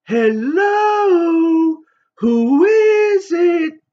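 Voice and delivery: very high, loud, stretched-out falsetto hello; mock the kid with a squeaky phone voice; drawn-out squeal of a phone greeting; silly falsetto. Teasing the girl again.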